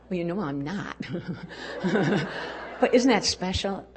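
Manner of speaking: usual speaking voice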